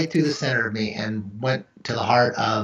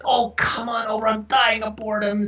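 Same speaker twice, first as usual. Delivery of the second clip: mock pleading; 8 semitones higher than usual. Pleading with passing mother and son.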